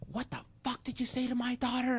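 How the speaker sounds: high-pitched mock freak-out; imitated outraged mom. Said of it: "she fucking freaked the fuck out".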